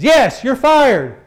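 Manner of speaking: loud, high, slow "you're fired"; angry boss drawing out the dismissal; boss firing him. Retold or imitated. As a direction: bark out the firing slowly. Boss fires him angrily.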